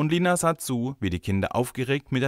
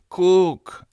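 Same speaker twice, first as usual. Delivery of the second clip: put-on loud, high child's demand; 10 semitones higher, 9 dB louder than usual. A narrator's reading. Boy shows the teacher his ruined hat, insistent.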